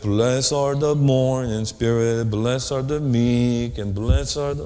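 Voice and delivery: slow, droning mindless recitation; mock a half-asleep Bible reader, dragging the words; halfway-coma reading. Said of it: "your mind is like in halfway coma".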